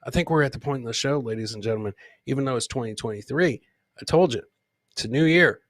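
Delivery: ordinary talk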